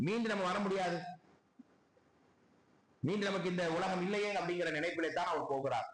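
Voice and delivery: normal talking voice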